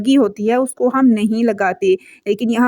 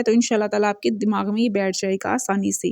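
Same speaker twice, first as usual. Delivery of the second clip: ordinary talk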